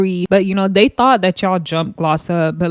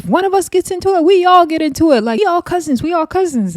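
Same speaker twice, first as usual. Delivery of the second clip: high mock rallying cry; 10 semitones higher than usual. Daisy rallying the girls.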